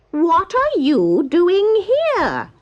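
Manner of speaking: high, loud, slow bird voice; a curious stork drawing out every word. Acted. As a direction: stork questioning the travellers on the bank. Stork greets travellers.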